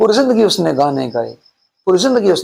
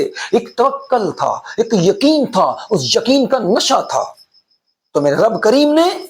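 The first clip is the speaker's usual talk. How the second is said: higher, fervent sermon voice; impassioned preaching; 8 semitones higher than usual. Acted. Preacher tells viewers of God's mercy, fervent and raised. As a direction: preach it with rising fervour.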